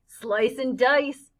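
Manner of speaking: slow, loud drawn-out threat; menacing yell. Acted. Bully threatens the boys with a knife, slow menacing yell. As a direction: relish the threat.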